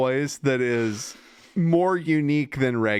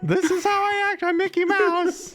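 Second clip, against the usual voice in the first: high cartoon mouse voice, much higher; Mickey Mouse. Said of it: "That was a good Mickey Mouse".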